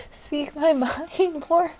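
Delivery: slow, high, giggling confession; laughing. Real, not acted. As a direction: laugh it out, embarrassed. Laughing at her drunk self.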